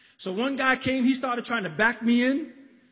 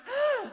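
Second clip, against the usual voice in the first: a high short "ah" from the corpse; a mock high moan from the body; corpse's groan; 14 semitones higher than usual. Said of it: "It said something like".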